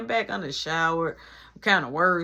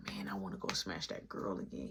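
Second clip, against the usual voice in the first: hushed boast; 12 semitones lower, 14 dB quieter than usual. Retold or imitated. Friend's boast told in hushed tone.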